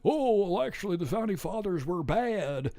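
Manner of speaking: lightly voiced dopey professor; Chomsky voice. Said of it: "he's doing the Chomsky voice at the end".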